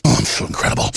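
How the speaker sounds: deep, then unvoiced smug gloat; smug mock voice. Host mocking Gruber's smug self-praise, deep gloating voice. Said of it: "He's like".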